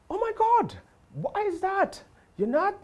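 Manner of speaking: high, incredulous mock female voice; shocked counter lady.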